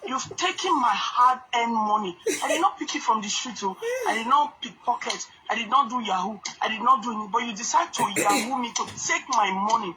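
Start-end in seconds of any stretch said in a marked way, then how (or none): none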